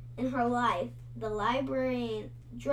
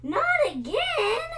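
much higher, louder dismayed exclamation; dismayed cry; 12 semitones higher, 6 dB louder than usual. A narrator's reading. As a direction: gasp it out in dismay. Redhead dismayed at news.

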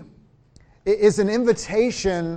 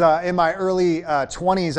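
normal talking voice

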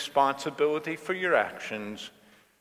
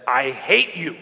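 put-on louder, a sharp childish I hate you; angry teen outburst; 2 semitones higher, 7 dB louder than usual. Daughter storming in, hurling it at her mother, loud and angry. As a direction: fling it at your mother like a slammed door.

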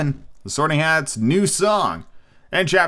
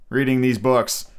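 ordinary talk

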